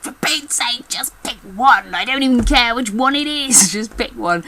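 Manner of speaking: high-pitched mock exasperation; hammed-up exasperated plea, easing off at the end; exasperated director. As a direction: imitate a director at the end of their tether. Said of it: "the director was like".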